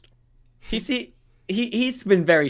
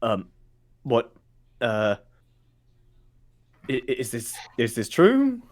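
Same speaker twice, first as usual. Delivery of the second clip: confused guard. Acted. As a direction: stumble through it, baffled. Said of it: "confused and just Lowry and goes".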